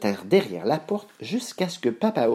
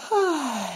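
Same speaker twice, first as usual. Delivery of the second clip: put-on high, drawn-out girlish yawn. A narrator's reading. Boucle d'Or tired before the three beds, high yawn. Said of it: "dit Boucle d'Or en bâillant".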